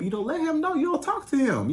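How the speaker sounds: ordinary talk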